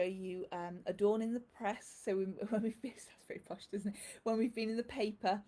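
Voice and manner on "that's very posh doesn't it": breathy whispered aside, half to herself. Real, not acted. Host whispering a joke to viewers about the press.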